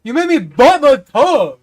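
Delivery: high, loud mock outrage; outraged whiny shout. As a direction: squeal it like he's been wronged. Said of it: "He's like".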